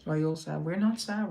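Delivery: ordinary delivery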